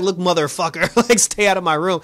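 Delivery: half-hearted angry snap; mock cursing.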